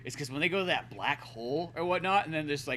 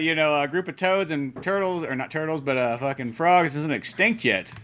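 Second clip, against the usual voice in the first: ordinary delivery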